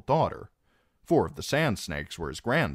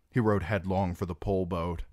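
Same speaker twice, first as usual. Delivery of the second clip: plain reading voice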